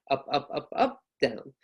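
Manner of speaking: each "up" lifted, "down" dropped; up-then-down list tune. Shown as a demonstration. Teacher counting five things, up then down intonation. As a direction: repeat "up" lifted, then drop on "down". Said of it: "it goes up until the last one".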